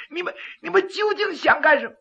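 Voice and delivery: panicked stammer. Acted. Magistrate panicked.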